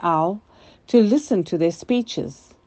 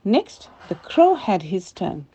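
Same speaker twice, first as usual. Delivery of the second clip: ordinary delivery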